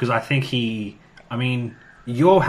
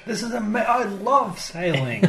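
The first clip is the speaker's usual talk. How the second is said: mock gushing delight; 10 semitones higher than usual. Mocking Barbara's eagerness for the ship.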